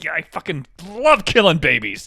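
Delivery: loud mocking cheer. Mocking activists who cheer, loud and gleeful. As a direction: ham up a gleeful fan.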